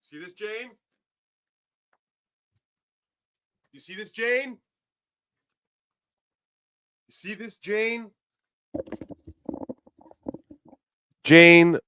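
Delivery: very high, loud squeaky taunting voice; high-pitched mocking jeer aimed at Jane. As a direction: jeer at her in a shrill, high mock voice. Taunting Jane Fonda with sign.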